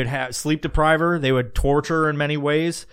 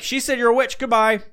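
higher-pitched dismissive jury; mock hasty verdict; 7 semitones higher than usual. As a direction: hand down a glib verdict, pitched up. Acts jury condemning on hearsay.

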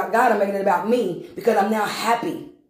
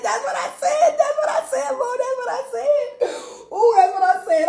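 much higher, laughing and half crying. Real, not acted. Overcome with laughter before the church. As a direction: squeal it through laughter. Repeats through laughter and tears.

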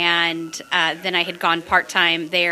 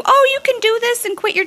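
much higher, louder, sing-song mock; mocking advisers; 6 dB louder than usual. Mocking people who say quit your job, high sing-song. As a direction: parody the bad advice.

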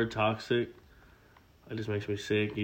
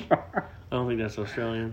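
usual speaking voice

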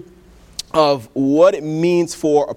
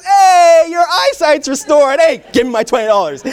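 triumphant cheer; 11 semitones higher, 7 dB louder than usual. Acted. Doctor to lawyer caught faking blindness, triumphant cheer. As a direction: crow the punchline in mock celebration.

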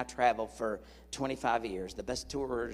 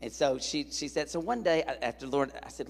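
ordinary delivery

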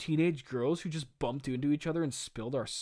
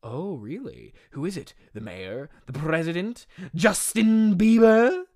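theatrical villain building to a loud peak; teasing questions, exploding on the drawn-out name; hammed-up maniac guessing game; Cletus Cassidy, mad guessing; 7 dB louder than usual. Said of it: "says something very strange".

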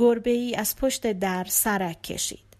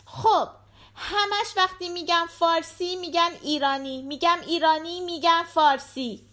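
put-on high-pitched little girl chatting to her dad; little daughter; 8 semitones higher than usual. A narrator's reading. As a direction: voice a young daughter, pitched well up. Voices the daughter. (صدای زیر دختربچه)

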